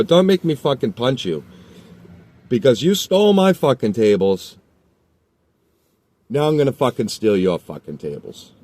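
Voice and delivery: angry lecture. Retold or imitated. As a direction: scold the thief hard. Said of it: "I go".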